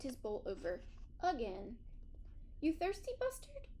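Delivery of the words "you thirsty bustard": much higher, sing-song question; kindly old dog asking. A narrator's reading. Geraldine asks thirsty Buster, higher, teasing and kind. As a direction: tease the thirsty pup gently. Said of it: "Geraldine asked".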